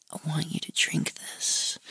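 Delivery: soft, near-whispered come-on; whispered coaxing. Retold or imitated. Client to man with closed eyes, whispered coaxing. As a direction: coax in a whisper, acting out the caller.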